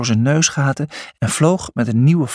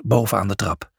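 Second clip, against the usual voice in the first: ordinary delivery, nothing marked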